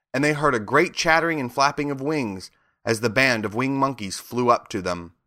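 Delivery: ordinary delivery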